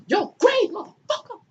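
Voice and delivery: high, shouting put-on Cain; spring the attack with a yell; hammed-up savage shout; mock murderous yell. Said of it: "Cain is like".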